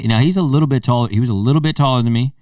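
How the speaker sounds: ordinary delivery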